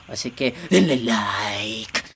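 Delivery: low, slow drawn-out plea for likes; voz grave alargada. Pretend. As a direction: drop deep and stretch it out. Asks viewers in drawn-out low voice. (voz grave, lenta y alargada)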